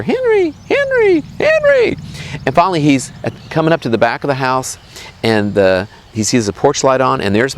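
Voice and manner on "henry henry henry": very high call, growing stronger each time; louder and louder calling. Acted. Boy nears the house as Mom calls, high yell getting louder. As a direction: mimic Mom's yell coming closer, building in volume. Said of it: "voices getting louder and louder".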